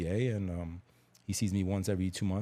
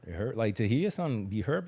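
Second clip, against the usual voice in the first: ordinary talk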